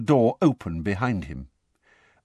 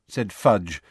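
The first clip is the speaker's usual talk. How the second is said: ordinary delivery, nothing marked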